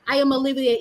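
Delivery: ordinary delivery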